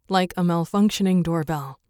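usual speaking voice

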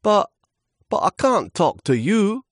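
very high, hesitant, loud; quivering little leaf. Acted. Overawed leaf to the mighty wind, quivering. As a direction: stammer it out high and nervously. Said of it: "Lewis quivered".

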